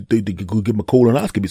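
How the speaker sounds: usual speaking voice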